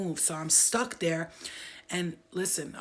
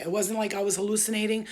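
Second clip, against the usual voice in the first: usual speaking voice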